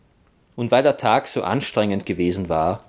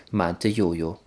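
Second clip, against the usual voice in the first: ordinary delivery, nothing marked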